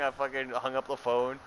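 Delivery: usual speaking voice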